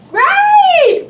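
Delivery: very high, loud "Right"; hammed-up excited yelp; excited cry. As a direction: burst out with delight. Excited toy play.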